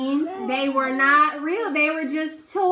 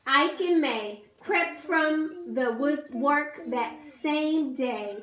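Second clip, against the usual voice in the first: ordinary delivery, nothing marked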